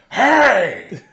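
loud, brash Pacino-style "Hey!" bursting out of a tiny cute dog; Al Pacino shout. Acted. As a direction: bark it like Pacino, sudden and loud. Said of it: "it's fucking Pacino".

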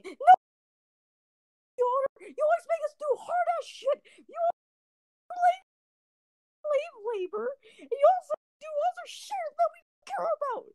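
extremely high falsetto tantrum; ballistic rant. Retold or imitated. Angry kid refusing to calm down, imitated squealing rant. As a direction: squeal the complaint like a kid in meltdown. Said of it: "it was a ballistic moment".

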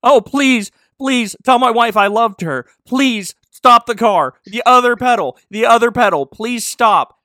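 loud, high, desperate begging; panicked pleading. Acted. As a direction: beg for your life, hammed up. Sheriff pleading in terror.

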